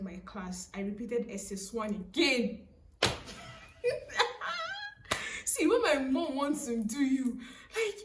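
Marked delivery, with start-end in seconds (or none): 3.0-5.4 high-pitched laugh, almost crying; high wailing laugh. Real, not acted. Telling how mom repeated her class, a real high, wailing laugh. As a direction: crack up in a long, high wail.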